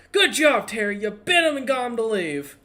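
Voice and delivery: high, loud proud cheer; gleeful praise. Acted. Shopkeeper cheering his terrier, high loud praise. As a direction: praise the dog from afar. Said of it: "you hear the human guy go".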